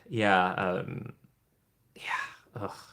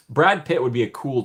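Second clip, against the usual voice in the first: normal talking voice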